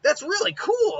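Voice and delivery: high, slowed, amazed exclaim; wide-eyed wonder at a trick; excited kid. Acted. Naruto amazed by the twins' technique, high and excited. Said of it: "It's like".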